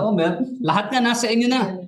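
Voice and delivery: very sarcastic. Retold or imitated. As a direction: act out a sarcastic jab. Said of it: "Very sarcastic siya".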